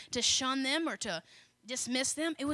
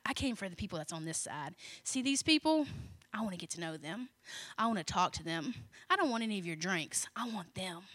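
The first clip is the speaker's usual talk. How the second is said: quiet earnest; 6 semitones lower, 6 dB quieter than usual. Acted. Jesus voiced at the bar.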